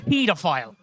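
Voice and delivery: Pedophile said as "PEE-duh-file"; long PEE pronunciation. Shown as a demonstration. Contrasts two ways of saying it.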